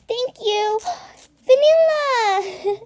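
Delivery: put-on high child character voice, last word drawn out; Elizabeth. Shown as a demonstration. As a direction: put on a very high little-girl voice. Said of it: "so that's my impression".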